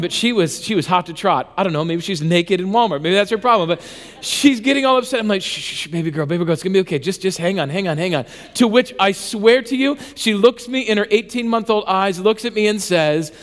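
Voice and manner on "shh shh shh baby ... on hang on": put-on soft, low shushing to calm a baby; hushed soothing. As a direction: imitate a dad quickly hushing his upset little girl. Soothing his fussy toddler in store.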